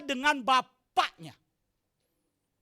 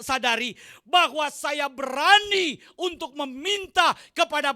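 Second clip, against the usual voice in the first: full-force preaching; 8 dB louder than usual. Acted. Pastor exhorting the congregation with full force. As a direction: preach it out to the church.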